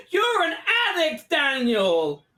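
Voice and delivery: high-pitched retold yell, drawn out at the end; mock yell. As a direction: call out the accusation, hammed up. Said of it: "Sam fully just yells".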